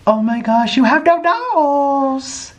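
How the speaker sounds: high, drawn-out coo; cooing pet talk. Real, not acted. Owner greets a new kitten with no nose, cooing. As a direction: coo slowly at a tiny kitten.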